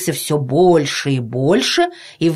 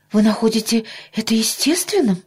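higher, mildly astonished question; surprised mother; 6 semitones higher than usual. A narrator's reading. Mother reacting to the doctor calling it natural, surprised. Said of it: "От удивления мамины глаза".

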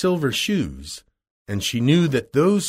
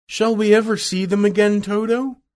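put-on high-pitched little girl asking; Dorothy's voice; 9 semitones higher, 4 dB louder than usual. A narrator's reading. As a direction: lift it into a high, wondering question. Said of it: "said Dorothy".

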